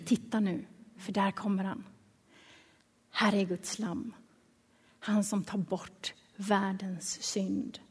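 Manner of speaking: low, hushed retold call. John calls his followers as Jesus passes, hushed and low. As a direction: point him out softly, with reverence. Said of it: "Johannes ropar på de sina".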